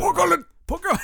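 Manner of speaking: very high cartoonish character voice; character accent. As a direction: squeal it as a silly character. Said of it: "it's one of those rare, uh, character accents".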